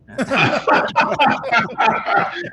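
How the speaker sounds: loud, high burst of laughter; hearty laugh. Real, not acted. Storyteller cracking up at his own punchline, hearty laugh.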